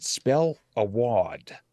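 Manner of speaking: slow, drawn-out mock Boston accent; Boston nun accent. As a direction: imitate an old nun's broad Boston accent.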